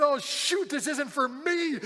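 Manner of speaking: high, loud mock dismay, slowing at the end; dismayed realization. Worker realizing the bonus isn't his, mock dismay. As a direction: imitate a comic letdown.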